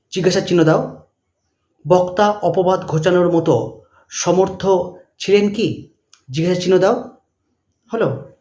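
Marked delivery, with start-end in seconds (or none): 1.9-5.9 dictating. Shown as a demonstration. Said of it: "dash দিয়ে লিখবে".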